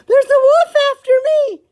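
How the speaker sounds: high, loud breathless pig; gasped. Acted. Pig who ran from the wolf, gasping high and loud. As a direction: blurt it out gasping for air. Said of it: "he gasped".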